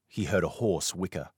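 ordinary delivery

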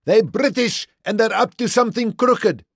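high, loud bellow. A narrator's reading. Boer rousing the crowd against the British, bellowing. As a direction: roar at the crowd to stop them. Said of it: "the Boer bellowed".

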